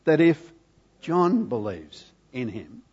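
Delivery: usual speaking voice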